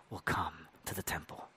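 hushed unvoiced whisper. Real, not acted. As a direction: let it fall into a whisper. Whispered end of quoted prophecy.

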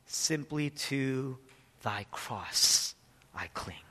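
slow, whispered close; hushed hymn line. Acted. Preacher reciting hymn to congregation, slow whisper. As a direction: let the last words fall to a whisper.

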